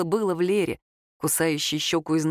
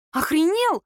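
high, louder yelp of indignation; squealed outrage; 9 semitones higher, 4 dB louder, slower than usual. A narrator's reading. Girl snaps back at his lewd condition, squealing. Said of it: "взвизгнула Лера".

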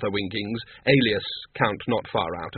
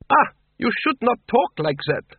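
high-pitched, haughty vampire lady; grand accented reproof; 12 semitones higher than usual. A narrator's reading. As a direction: scold with lofty disdain, pitched up. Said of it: "said Doreen haughtily".

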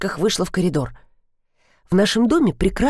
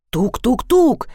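raised, insistent knock-knock imitation; imitated knocking; 4 semitones higher, 3 dB louder, slower than usual. A narrator's reading. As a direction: repeat the knocking sound emphatically, last knock highest. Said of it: "повторила я". (настойчиво изображая стук)

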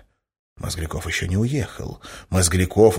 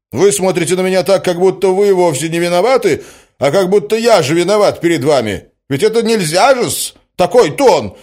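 high, loud, indignant protest; exasperated cry; 11 semitones higher, 9 dB louder than usual. A narrator's reading. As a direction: protest as if past all patience. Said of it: "вскричал озадаченный Мозгляков". (возмущённо, на повышенных тонах)

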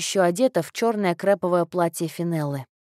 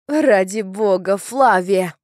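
loud, emphatic cry of exasperation; frantic shout; 7 dB louder, slower than usual. A narrator's reading. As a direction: cry out to make her stop. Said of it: "закричала Парслин". (отчаянный крик)

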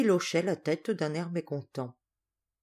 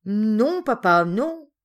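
louder, swooping protest; nervous denial; 3 semitones higher, 6 dB louder, slower than usual. Acted. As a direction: deny it like a caught child. Caught boy nervously denies it.

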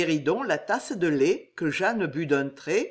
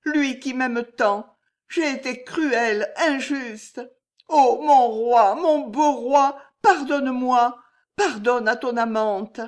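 higher, slowed, aching plea to her absent king; tearful lament; 6 semitones higher, 6 dB louder than usual. A narrator's reading. Jeanne begging her absent king for forgiveness, tearful. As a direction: pour out remorse as if close to tears. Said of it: "en pleurant".

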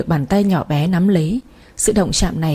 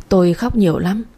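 plain reading voice

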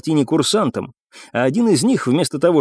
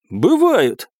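higher-pitched contradiction; confident retort; 7 semitones higher than usual. A narrator's reading. Hidden commoner steps out to contradict the baron, confident. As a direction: announce yourself with one word.

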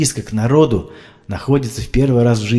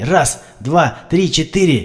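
frantic CPR; 6 semitones higher, much slower than usual. A narrator's reading. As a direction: keep pumping, voice climbing in despair. Frantic CPR counting and pulse.

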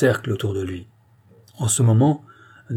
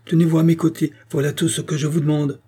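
higher, raised instruction; called-out order; 6 semitones higher than usual. A narrator's reading. King steadying his guards, calling out high. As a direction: keep calling, easing a little at the end. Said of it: "cria le roi".

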